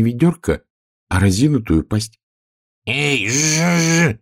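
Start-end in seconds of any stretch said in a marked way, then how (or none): 2.9-4.2 high hey with a long buzz; buzzing bee. A narrator's reading. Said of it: "зажужжала пчела".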